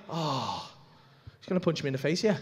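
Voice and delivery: low, hushed dawning dread; resigned realisation. Retold or imitated. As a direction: drop to a small, low voice. Realises a punch is coming.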